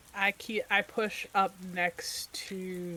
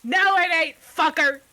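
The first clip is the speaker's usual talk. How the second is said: higher, louder taunting shout; shouting back; 9 semitones higher, 9 dB louder than usual. Acted. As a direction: yell it right back.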